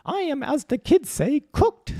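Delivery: slow, high, loud mock Finnish accent; hammed-up Finnish accent with careful slow delivery; Elsa's Finnish accent. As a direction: draw it out in falsetto Finnish English. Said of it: "In, like, her Finnish accent".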